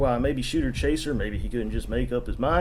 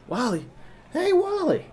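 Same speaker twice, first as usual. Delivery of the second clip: calling across the bar, sharply higher. Retold or imitated. Jonesy calls across the bar to Wally, much higher. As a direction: hail a buddy from the far end of the room.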